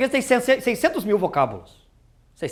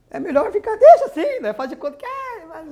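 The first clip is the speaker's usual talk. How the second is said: laughing; 10 semitones higher than usual. Real, not acted. Joking about death, laughing.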